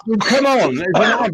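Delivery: a hint of the young presenter; mock kid voice. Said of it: "And you were like".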